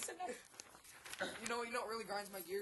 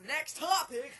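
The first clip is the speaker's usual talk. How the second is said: louder, higher call; raised-voice segment call; 6 semitones higher, 7 dB louder, slower than usual. Real, not acted. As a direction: announce it over the chatter. Calling the next segment over chatter.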